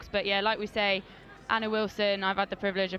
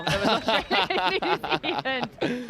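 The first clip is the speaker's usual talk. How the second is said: laughing at own slip, louder and drawn out; 2 semitones higher, 4 dB louder, slower than usual. Real, not acted. Co-commentator owning up to mixing up the teams, laughing. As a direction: break into a laugh mid-sentence.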